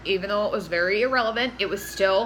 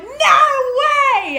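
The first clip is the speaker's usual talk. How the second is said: high, loud "No way!"; shocked shout; 13 semitones higher, 9 dB louder than usual. Retold or imitated. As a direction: scream it in disbelief at the show. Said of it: "I'm like".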